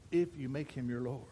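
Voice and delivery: usual speaking voice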